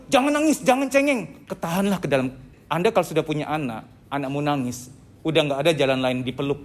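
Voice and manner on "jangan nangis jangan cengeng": high-pitched mock parental scolding. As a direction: scold a crying child, pitch jumped up. Acted parent scolding crying child. (omelan orang tua bernada tinggi)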